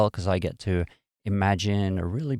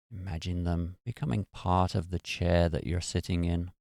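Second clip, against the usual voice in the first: guided meditation; 4 dB quieter than usual. Real, not acted. Meditation host talking listeners through releasing muscle tension, guided meditation. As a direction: ease them gently into relaxation, unhurried and even.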